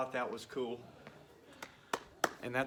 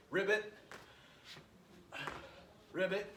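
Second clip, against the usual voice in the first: lightly voiced frog, higher pitch; a hint of a frog in a raised ribbit; 4 semitones higher than usual. Coach acting out a swimming frog, light ribbit. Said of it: "is a frog that swims".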